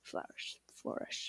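"Flourish." breathed with no voice; whispered flourish. Shown as a demonstration. Practising the word flourish, whispering it. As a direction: whisper "Flourish." twice to yourself. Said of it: "I need a flourish".

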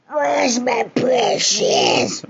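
slow, wailing yell for the precious; desperate shout. Acted. Creature's repeated, desperate demand.

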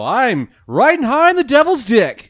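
high, loud, slow boast; excited. Real, not acted. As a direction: brag it out, delighted. Boastful joke about gin.

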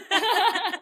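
high-pitched laughing fit; high laugh burst. Real, not acted. Friend laughs at her own joke, high laugh. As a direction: let out a high, loud laugh.

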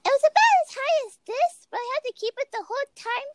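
very high-pitched squeaky little mouse voice. Shown as a demonstration. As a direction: demo a tiny squeak, far above your own pitch. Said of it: "did I have a squeaky voice".

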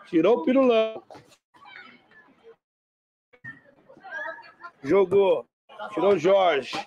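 play-by-play commentary. Acted. Live match commentary.

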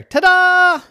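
high, loud drawn-out fanfare; triumphant ta-da. A narrator's reading. Little gymnast announces herself to the family, triumphant. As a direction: belt it out like a little show-off.

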